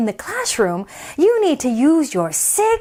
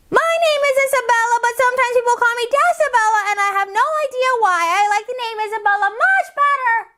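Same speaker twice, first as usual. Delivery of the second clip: put-on high, fast chatty little girl; 10 semitones higher, faster than usual. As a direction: rattle it off like an excited kid. Child character voice.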